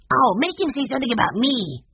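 put-on high, pleading boy's voice; begging. A narrator's reading. Mike begging Peter to make the bird talk, high and pleading. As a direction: beg eagerly like a small boy. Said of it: "begged Mike".

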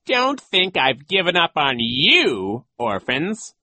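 loud, high, slow villain; taunting call. A narrator's reading. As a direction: call back menacingly to the orphans. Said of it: "he called to them".

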